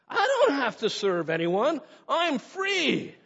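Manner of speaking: high, drawn-out mock boast of freedom; self-sufficient boast. Believer bringing self-sufficiency to the cross, mock boastful. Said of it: "with our self-sufficiency".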